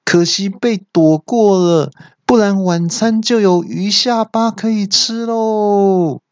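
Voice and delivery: high, stretched mock cheer; cheeky onlooker hollering praise; loud cheering. A narrator's reading. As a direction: taunt loudly from the sidelines. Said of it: "我就放声大叫说".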